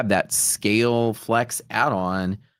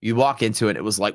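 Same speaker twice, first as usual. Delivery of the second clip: ordinary talk, nothing marked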